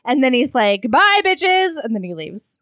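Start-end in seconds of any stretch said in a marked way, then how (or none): 0.9-1.8 high, loud sassy goodbye; sassy exit. Retold or imitated. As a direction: toss it off like a diva. Quoting sassy exit line.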